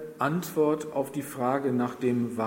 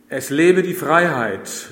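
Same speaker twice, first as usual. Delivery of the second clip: loud, slow call of freedom; ringing cry of defiance before death; defiant cry; 3 semitones higher, 10 dB louder than usual. A narrator's reading. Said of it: "riefen die beiden Erwachsenen".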